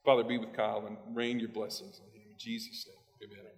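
low, hushed closing prayer; quiet, subdued prayer before the talk. Real, not acted. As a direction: pray softly and low, easing into amen.

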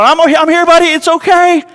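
high, loud soothing call across the store; frantic dad calling to his bawling son; calling out reassurance. Retold or imitated. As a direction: shout comfort to a scared child while running. Running to his crying son.

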